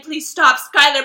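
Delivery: loud, urgent plea; pleading interruption. Retold or imitated. Mortified friend begging the singer to stop, loud plea. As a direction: cut in over the noise and beg.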